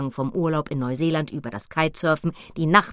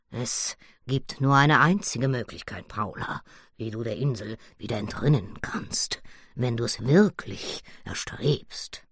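solemn gorilla; 6 semitones lower than usual. Acted. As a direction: announce the only way out gravely. (Badabamba, tief, langsam und feierlich)

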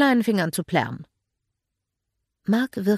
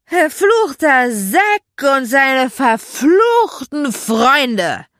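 loud, high, slow, slurred rant; drunken slurred cursing; 8 semitones higher, 10 dB louder, much slower than usual. A narrator's reading. Drunk Magda ranting on the terrace, slurred shouting. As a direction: curse them loudly and drunkenly. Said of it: "lallte Magda".

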